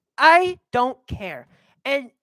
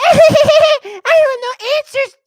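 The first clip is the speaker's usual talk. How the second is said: hammed-up laughing impression of the vice president; mocking laugh; 14 semitones higher, 12 dB louder than usual. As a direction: cackle through the words to mock her.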